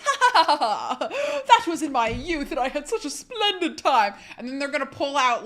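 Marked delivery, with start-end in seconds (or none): 0.0-4.2 high, loud theatrical laugh into grand reminiscing; hammed-up famous actor. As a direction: play a grand celebrity laughing off the past. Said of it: "I'm gonna be like".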